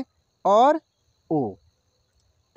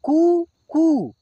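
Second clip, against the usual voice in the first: कु drawn long and higher; slow syllable demo; 4 semitones higher, much slower than usual. Shown as a demonstration. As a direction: stretch out कु, कु for the class. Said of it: "देख रहे हैं ना". (कु, कु को धीरे खींचकर बोलें)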